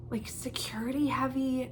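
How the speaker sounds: soft unvoiced whisper on security heavy; whispered aside. Real, not acted. Mid-sentence whispered aside.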